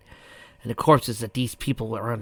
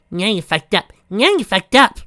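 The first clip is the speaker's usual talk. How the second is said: sing-song taunt; 10 semitones higher, 11 dB louder than usual. Acted. Pinky chanting taunt.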